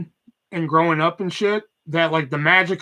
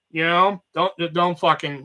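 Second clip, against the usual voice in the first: usual speaking voice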